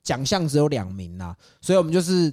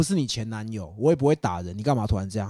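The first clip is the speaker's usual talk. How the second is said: lower, softer calming voice; soothing; 7 semitones lower, 5 dB quieter than usual. Retold or imitated. Calming the crying girl in bed, low soft reassurance. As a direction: talk her down gently, low and soft.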